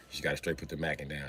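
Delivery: usual speaking voice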